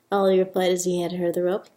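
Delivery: ordinary delivery, nothing marked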